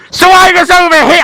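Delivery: sudden high, very loud shout; blaring game voice. Retold or imitated. Bugged game character suddenly blaring at the player, shouted high. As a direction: blast it like a glitched game voice.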